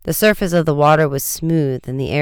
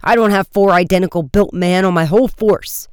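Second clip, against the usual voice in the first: exasperated, higher-pitched protest; exploding; 5 semitones higher, 4 dB louder than usual. A narrator's reading. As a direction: keep the indignant outburst going. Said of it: "the chief exploded".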